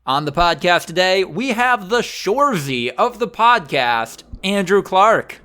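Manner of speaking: upbeat announcer read, bright and lifted; hammed-up radio host opening; podcast host intro. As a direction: welcome the listeners like a show host. Host opens the episode.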